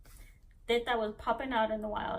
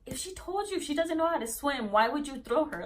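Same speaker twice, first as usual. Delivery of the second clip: higher, indignant mom impression; mock outraged mom, pitched up and rising in force; pissed mom; 7 semitones higher, 3 dB louder than usual. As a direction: re-enact an angry mother's scolding. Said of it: "she was, she was pissed".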